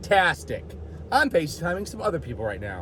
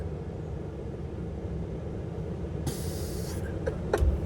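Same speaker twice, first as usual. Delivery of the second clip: imitating a straining old swimmer; 11 dB quieter than usual. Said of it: "He's like".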